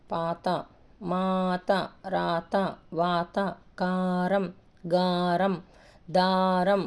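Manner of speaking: first syllable held in "పాత", "కారం", "దారం"; long-vowel reading. Shown as a demonstration. Teacher showing long vowels, drawn-out "పాత" to "దారం". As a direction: stretch the opening vowel of each word, "మాత", "గారం". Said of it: "దీర్ఘమున్న చోట ఎలా పలుకుతున్నానో".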